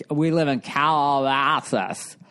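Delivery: slow Valley drawl, mouth held open to the end; Californians impression. Joking about the SNL sketch, a drawled Valley voice. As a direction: stretch every word like The Californians sketch. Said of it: "say a word and they wouldn't close their mouth".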